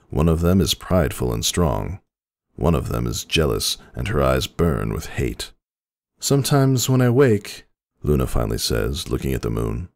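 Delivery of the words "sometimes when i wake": much higher, concerned younger sister; a high, fretful princess voice; Luna's worried voice. A narrator's reading. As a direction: ask with anxious care. Luna voiced in story.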